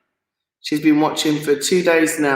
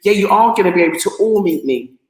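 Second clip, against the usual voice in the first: usual speaking voice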